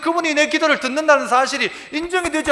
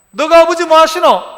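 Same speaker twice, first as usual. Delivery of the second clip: loud challenge; 4 semitones higher, 8 dB louder than usual. Acted. Preacher demanding the congregation's father, in a loud challenge. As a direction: pitch raised, louder, hammered as a demand. Preacher thunders the movie line.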